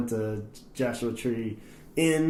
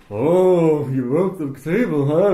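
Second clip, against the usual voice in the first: loud, drawn-out Southern California oddball; Crazy Lance; 3 semitones higher, 5 dB louder, slower than usual. Retold or imitated. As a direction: imitate the weird pool guy, louder. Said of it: "Crazy Lance comes out".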